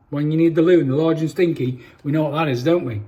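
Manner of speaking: ordinary delivery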